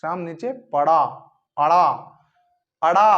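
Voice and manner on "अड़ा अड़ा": अड़ा with a flapped retroflex ड़, tongue flicked back. Shown as a demonstration. Teacher demonstrating अड़ा to students, a careful flap. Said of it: "अड़ा शब्द आता है".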